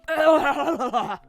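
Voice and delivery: put-on girlish laugh. Girl laughs at the club.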